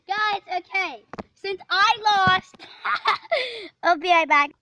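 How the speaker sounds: loud, high, laughing through words. Real, not acted. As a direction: laugh while you say it. Laughing after losing.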